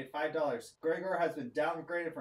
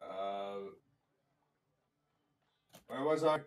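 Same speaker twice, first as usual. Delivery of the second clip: gruff cyclops; 4 semitones lower than usual. Acted. GM voices cyclops counting on fingers.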